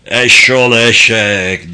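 loud, slow bellowed insult; drawn-out acted shout. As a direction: bellow it as an old man, drawing it out. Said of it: "diye haykırdı adam".

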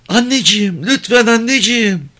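put-on high, pleading little-girl voice; yalvaran küçük kız. A narrator's reading. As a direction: plead to mother as a small child, high-pitched. Child pleading with mother in story. (yalvaran tiz çocuk sesi)

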